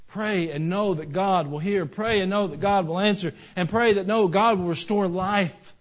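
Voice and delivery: rhythmic exhortation, rising in force; forceful preaching. Real, not acted. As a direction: urge the congregation with mounting intensity.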